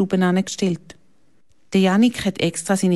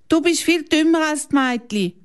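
higher, hurt little outburst; a bit angry; 12 semitones higher than usual. A narrator's reading. Janik wehrt sich ein bisschen wütend gegen Milan. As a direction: snap back in mild anger. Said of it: "ein bisschen wütig geworden".

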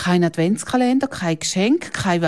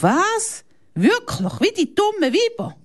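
much higher, incredulous cry; shocked disbelief. A narrator's reading. Milan fragt Janik fassungslos und ungläubig. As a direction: gasp out the disbelief. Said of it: "Der Milan reißt die Augen auf".

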